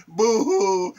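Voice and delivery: a hammed-up fake sob; jeering boo-hoo, pretending to weep; mock crying boo-hoo. Mocking the whiners with a fake boo-hoo sob.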